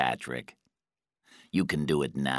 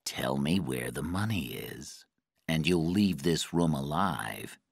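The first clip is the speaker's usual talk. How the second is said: soft, lower-pitched menace; quiet threat; 3 semitones lower, 5 dB quieter than usual. A narrator's reading. Guy offers Patrick life or death, quiet threat. Interrogator threatens a tortured prisoner.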